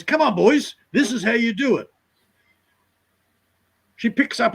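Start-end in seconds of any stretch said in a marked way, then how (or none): 0.0-1.9 mock bossy girl. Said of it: "trying to get the boys".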